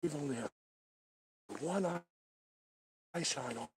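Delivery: very soft, broken-up murmur; hushed. Real, not acted. Night filmer reporting no eye shine, hushed. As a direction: keep your voice right down, pausing as you scan.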